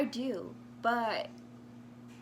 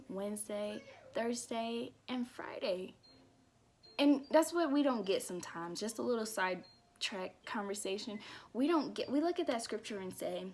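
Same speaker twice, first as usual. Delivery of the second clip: usual speaking voice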